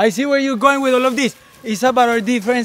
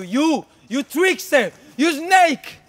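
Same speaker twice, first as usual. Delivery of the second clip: normal talking voice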